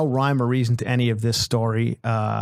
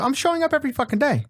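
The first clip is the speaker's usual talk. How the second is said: excited; 13 semitones higher, faster than usual. Real, not acted. Defending his story, excited.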